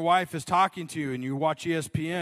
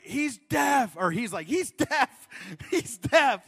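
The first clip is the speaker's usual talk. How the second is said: high, loud insistent announcement; telling the attendant; 7 semitones higher, 5 dB louder, slower than usual. Retold or imitated. Homeless man tells the attendant the customer is deaf, high and loud. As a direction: insist he can't hear. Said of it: "she's like".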